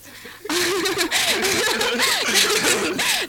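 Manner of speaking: sudden high loud laugh; burst of laughter. Real, not acted. Student laughs at rival's flyer claim, loud burst of laughter. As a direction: crack up at the claim.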